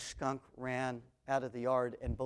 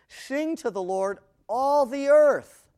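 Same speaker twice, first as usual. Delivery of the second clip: grand proclamation; 10 semitones higher, 13 dB louder, slower than usual. Acted.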